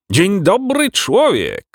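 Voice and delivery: high-pitched, exclaimed hello; excitable animal admiral crying hello; shouted greeting. A narrator's reading. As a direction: call it out high and hearty. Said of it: "krzyknął Verganza".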